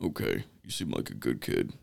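low, soft gruff cop, dismissive; mock deep cop letting him go. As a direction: drop low and wave the kid off. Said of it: "and he's like".

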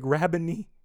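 high-pitched exclamation; crying out. A narrator's reading. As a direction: cry the title out. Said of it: "cried out in Aramaic".

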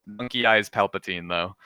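normal talking voice

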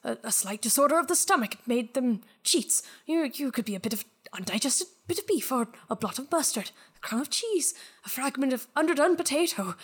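a hint of an anxious old man talking himself down; muttering miser. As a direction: reason away the ghost under your breath. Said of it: "mutters to himself".

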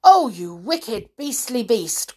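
aghast elephant's scolding; outraged cry. Acted. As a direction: cry the insult out slowly. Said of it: "cried Trunky".

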